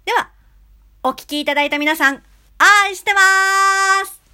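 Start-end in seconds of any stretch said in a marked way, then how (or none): 2.6-4.2 excited sign-off. Real, not acted. Loving sign-off to listeners.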